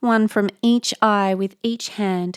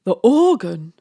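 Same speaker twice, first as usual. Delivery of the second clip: loud, startled echo; stammered surprise; 8 dB louder than usual. A narrator's reading. Squire echoing his wife, loud startled stammer. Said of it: "stammered the squire".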